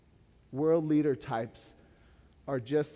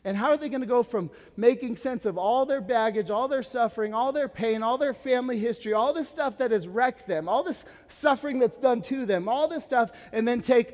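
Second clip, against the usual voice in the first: building preaching; 7 semitones higher, 5 dB louder than usual. Acted.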